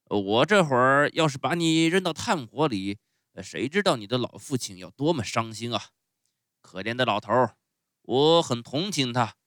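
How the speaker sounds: loud, theatrical ogre voice; blustering showman, pitying. Acted. Fire-eater pities Pinocchio's father, loud bluster. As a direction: boom out the sympathy.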